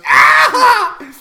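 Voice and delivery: very high, loud scream; piercing high-pitched yelp; character's shriek. Acted. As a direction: shriek out in alarm. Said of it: "Burb on your head goes".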